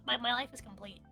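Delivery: ordinary talk, nothing marked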